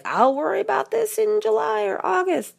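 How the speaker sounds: high, breezy put-off tone; procrastinator voice. Retold or imitated. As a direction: shrug it off carelessly. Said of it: "so please don't do that thing where you're like".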